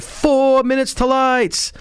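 high, long-drawn holler with stretched vowels; screamed call-out. Retold or imitated. Recruit on fire watch waking the platoon, drawn-out scream. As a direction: imitate a fire watch bellowing slowly down the barracks. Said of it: "you start screaming".

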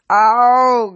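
loud, high, drawn-out "ow"; pained moan. A narrator's reading. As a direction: wail it in acted pain. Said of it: "Guido moaned".

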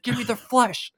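high, loud, manic Australian cartoon shriek; Australian Rick voice. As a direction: squeal it like a bloodthirsty Aussie knife. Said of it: "talks like Australian Rick".

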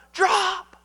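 high, sudden warning cry; sharp high call; re-enacted yell. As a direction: yell it as the alarmed father. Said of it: "and when I yelled".

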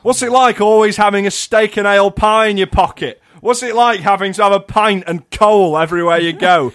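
very high, loud sneering toff voice; mock snobby southerner. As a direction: taunt the northerners, laughing. Continues snobby mockery.

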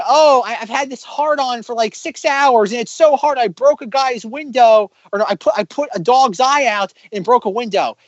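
a high-pitched, boastful oaf; imagined Chris Pratt. Retold or imitated. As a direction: brag like a clueless goof. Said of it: "And it's like".

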